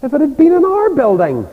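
lightly hammed-up petty pride; jealous church folk. Rival Ulster church claiming they'd do better, mock boast. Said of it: "and they said".

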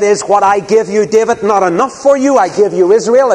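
loud, high indignant reproach; acted rebuke. God rebuking David, acted.

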